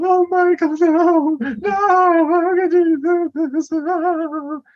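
high, loud, drawn-out fake sobbing; hammed-up grief over a dying lover; mock wailing. Acts Tammy wailing over dying Michael.

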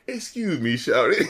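a hint of a soft, cool rapper. Reviewer quoting the rapper's smooth opener. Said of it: "the way he's so, like, cool".

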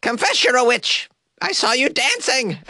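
high-pitched mock accusation; old-time witch accuser. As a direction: accuse like a hammed-up witch hunter. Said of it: "from the seventeen hundreds or something".

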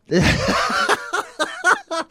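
high burst of laughter. Real, not acted. Laughs at own joke.

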